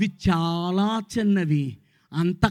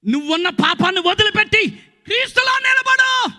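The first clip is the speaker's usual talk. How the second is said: preaching shout. Real, not acted. Preacher urging the church to leave sin, shouting. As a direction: cry it out to the crowd.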